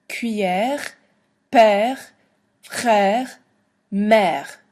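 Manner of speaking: Cuillère père frère mère with a hacked throaty R; teacher repeats French words, throaty R; French words with spat R. Shown as a demonstration. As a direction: model the words again, spitting the R. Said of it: "so that you can practice your spitting".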